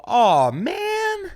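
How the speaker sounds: high drawn-out whine; whiny. Retold or imitated. Comic whining again at the insulting man, high whine. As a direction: moan it, wounded. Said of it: "I go".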